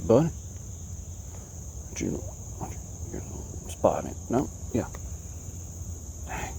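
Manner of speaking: ordinary delivery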